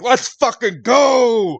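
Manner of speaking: high, loud, slow battle cry; hyped shout. Acted. Avia slamming the table, cheering to drink. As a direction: scream it with wild glee. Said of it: "She's like".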